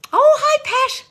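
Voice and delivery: squeals a viewer's name; delighted hello to a fan; high delighted greeting. Real, not acted.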